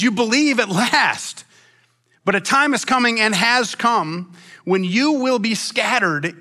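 preacher declaiming a Bible passage; scripture reading. A narrator's reading. Reads scripture aloud to congregation.

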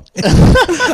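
real laugh at the prank call; high loud laugh. Real, not acted. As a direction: burst out laughing.

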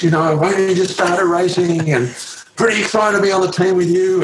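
put-on high, gushing young American fan voice; young Lance Armstrong. Young Lance greeting his new teammate, gushing and starstruck. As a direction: play the starstruck young rider meeting his hero. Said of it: "and he said".

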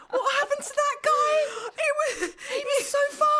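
high squeal through laughter; real laughing fit, pitch soaring; helpless laughter. Real, not acted. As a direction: wail it out, cracking up. Helpless laughter during mock lament.

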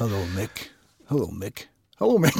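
put-on low, soft lines with a slight Irish accent; accidental Irish accent. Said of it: "It did sound a bit Irish there".

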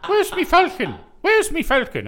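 high Irish "Where's me Falcon", loud and bright; Irish accent. Pretend. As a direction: pitch up into a stage Irishman. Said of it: "how would an Irishman say Falcon".

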